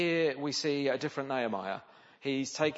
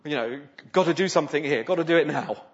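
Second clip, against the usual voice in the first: quick, louder, urgent plea to God; panicked arrow prayer; 7 dB louder than usual. Retold or imitated. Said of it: "a bit of a panicked arrow prayer".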